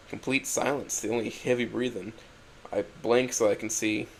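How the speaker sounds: ordinary delivery, nothing marked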